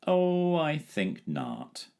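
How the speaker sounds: very slow, high, lazy drawl. A narrator's reading. Dragon lazily dismissing the danger, very slow. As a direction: stretch it out lazily. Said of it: "said the dragon in his lazy way".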